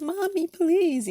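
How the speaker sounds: high, loud, slow whiny "Mommy, please"; pleading child. Retold or imitated. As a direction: stretch it out like an embarrassed kid begging mom. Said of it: "I used to be like".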